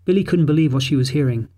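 ordinary delivery

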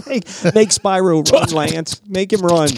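high, loud giggly pleading; goofy stoned demand. Retold or imitated. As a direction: egg him on, laughing and high. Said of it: "and be like".

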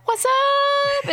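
man doing a woman's voice; target lady voice. Shown as a demonstration. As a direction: sing it up high like a cheery shop assistant. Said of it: "Jonathan calls it my target lady voice".

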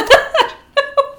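bursting into high laughter; loud high laugh. Real, not acted. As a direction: cackle at the failed accent. Reacting to bad accent.

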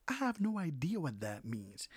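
ordinary delivery

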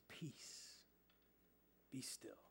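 whispered, soft calming words; quiet the storm with a whisper; hushed command. Retold or imitated. Jesus calms the storm.

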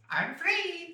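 very high gleeful squeal; gibbering madness. Pretend. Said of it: "scampers past houses and laughs".

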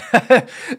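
high, loud laugh; real laugh at himself; burst of laughter. Real, not acted. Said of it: "sounds funny to even say it".

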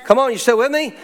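ordinary delivery

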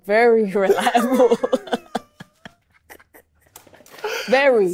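laughing through the words; amused, teasing echo; laughing sarcastic. Real, not acted. Mocking the reliable source claim.

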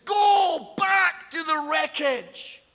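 loud, high, drawn-out retold command; imitated urgent pleading. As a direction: play a coastguard begging with full force. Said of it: "Italian Coast Guard begging the captain".

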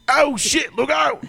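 high panicked yelp of a warning; mock alarmed shout. Retelling a comedy skit, a mock panicked shout. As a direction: shriek it like you just spotted the danger.

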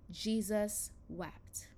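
slowed, hushed weight on both words; solemn slow reading. Acted. Preacher reading the shortest verse, slow and hushed. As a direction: let it land slowly and softly.

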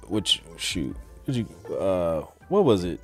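ordinary delivery

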